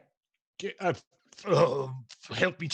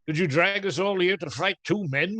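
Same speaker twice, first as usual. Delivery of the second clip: ordinary talk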